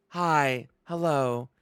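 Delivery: a hint of TV-movie greeting; Hallmark movie voice.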